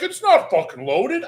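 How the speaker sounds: loud, drawn-out exasperated retort; exasperated dad quote; insistent retort. Retold or imitated. Retelling dad's exasperated insistence.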